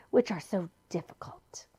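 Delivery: unvoiced sly whisper; soft sly whisper. A narrator's reading. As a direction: breathe it like a secret. Villain lures them.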